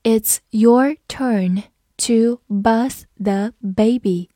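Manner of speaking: put-on "It's your turn to bath the baby" at half speed, each word clear; slow reading. Shown as a demonstration. Teacher announces a slow reading for students. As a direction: read it slowly so students can follow. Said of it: "好，我们慢读一遍".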